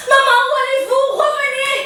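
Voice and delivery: high, loud shout after someone leaving; desperate calling. Acted. Daughter calling her retreating mother back, loud and desperate. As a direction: call her back in panic.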